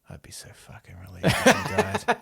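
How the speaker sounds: high, hushed bedside confession; creepy bedside mother. Acted. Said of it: "she creeps over and sits on the end of the bed".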